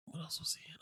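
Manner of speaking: whispered to self, musing. Real, not acted. Trying to remember an actor's role, whispered aside. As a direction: breathe the question to yourself.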